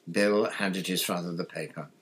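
ordinary delivery

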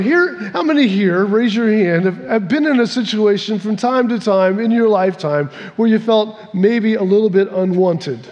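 normal talking voice

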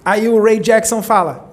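ordinary talk, nothing marked

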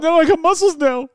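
high-pitched, hammed-up protest; mock desperate refusal. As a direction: fend off temptation in play-acted distress. Acting out refusing the tempting cake.

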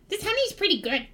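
a hint of a pleased goblin; honey-licking goblin. Goblin tastes honey off his hands, lightly voiced. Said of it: "he's gonna lick his hands. He's like".

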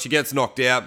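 ordinary talk, nothing marked

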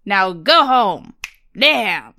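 loud, slow snapped order; snapping. Retold or imitated. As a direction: bark it out like a fed-up cop. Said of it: "He snaps".

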